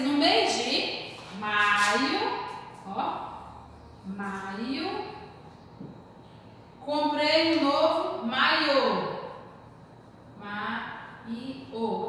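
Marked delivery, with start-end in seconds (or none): 1.4-2.4 "maio" drawn out slowly with the weight on MA; stress on first syllable. Shown as a demonstration. Teacher showing the unaccented word, slow stress on MA in "maio". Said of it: "sem o acento". 10.4-12.1 "Maiô" spelled out slowly, landing on the closed Ô; stress on final syllable. Shown as a demonstration. Teacher writing the accent, slow final stress in "Maiô". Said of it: "Só colocar o acento. Já dá outro tom".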